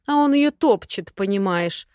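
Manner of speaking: ordinary delivery, nothing marked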